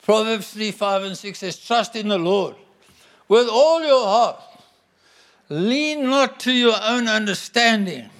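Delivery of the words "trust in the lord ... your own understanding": preaching scripture. Acted. Quoting Proverbs from the pulpit.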